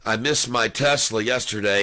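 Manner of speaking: usual speaking voice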